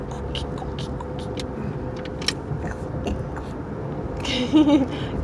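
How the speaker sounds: crab clicking. Shown as a demonstration. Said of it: "Crab says".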